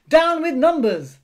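high, loud chant of protest; rowdy full-voiced slogan; yelled. A narrator's reading. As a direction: yell it like a rebellious crowd. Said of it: "they yelled".

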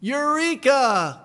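loud, high Eureka, drawn out; shout. Shown as a demonstration. Preacher urging Christians to shout with Archimedes, loud. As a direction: proclaim it with joy. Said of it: "should be able to shout with".

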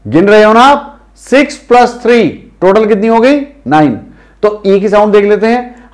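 usual speaking voice

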